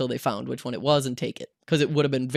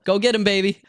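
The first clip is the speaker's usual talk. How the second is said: loud, raised cheering-on; higher, louder rallying call; retold yell; 6 semitones higher, 5 dB louder than usual. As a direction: imitate a guard yelling encouragement. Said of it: "one of the security guards yell".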